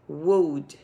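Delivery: "would" repeated with no O vowel, short and falling; would without O. Shown as a demonstration. Teacher repeats "would" for the class. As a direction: say "would" again as a clipped "ud". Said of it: "Eu vou falar como se fosse o L D".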